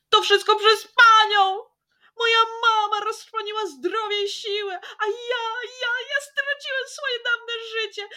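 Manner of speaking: high, loud, breaking into tears; tearful outburst. Acted. Jasiek blaming the lady, tearful high outburst. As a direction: shout through rising sobs. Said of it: "krzyknął rozżalony Jasiek".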